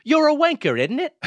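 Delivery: high, hammed-up British accent. Co-host topping the British accent bit, in British accent. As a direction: pitch it up into a mock British jibe.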